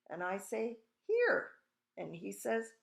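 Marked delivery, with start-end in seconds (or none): 1.1-1.5 very high, loud chirp; piping little boy. A narrator's reading. Invisible boy answers his mother, loud piping call. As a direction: pipe up loudly in a boy's pitch.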